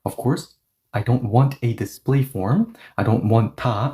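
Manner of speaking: ordinary talk, nothing marked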